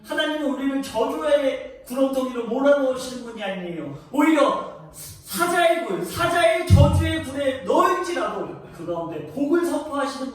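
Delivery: loud, high, swelling pulpit declamation; preaching at full force. Acted. Pastor proclaiming God's love to the congregation, full-force preaching.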